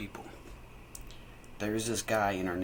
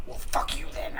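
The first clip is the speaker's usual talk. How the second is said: higher, put-on huffy voice; imitated mad neighbor; 6 semitones higher than usual. Ignored neighbor snapping back at kids, imitated anger. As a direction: mimic him getting mad. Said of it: "he'd get really mad".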